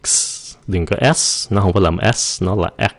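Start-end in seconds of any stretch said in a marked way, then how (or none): 0.0-0.6 X with only a whispered breath of air at the end; X ending in a hiss. Shown as a demonstration. Teacher shows students how to release X. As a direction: stop on the K, then hiss out the X. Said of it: "Cái xong rồi xì nó".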